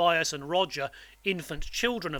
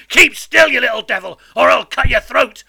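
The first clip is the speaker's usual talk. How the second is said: loud, raised, rough-spoken convict; terrible threatening shout; 6 semitones higher, 16 dB louder than usual. A narrator's reading. Fearful man threatens to cut boy's throat, shouting. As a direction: threaten the child at full volume. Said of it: "cried a terrible voice".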